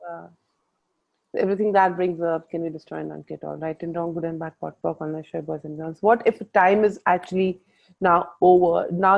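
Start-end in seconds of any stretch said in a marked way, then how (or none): 2.5-6.0 fast, soft ritual recitation; quick murmured incantation, much softer; rapid clearing statement. Acted. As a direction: rattle off the formula under your breath.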